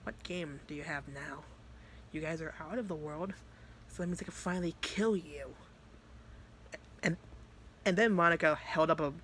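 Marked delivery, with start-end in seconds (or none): 0.0-5.5 hushed, measured villain taunt; threatening. Retold or imitated. Vice promising to kill Monica, quietly threatening. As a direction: state the threat softly and calmly. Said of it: "they just literally were just threatening each other".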